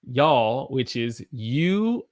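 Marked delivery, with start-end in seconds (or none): 0.1-0.7 y'all as one syllable, yawl; Southern y'all. Shown as a demonstration. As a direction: say y'all slow and long. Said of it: "Can you say it with me?".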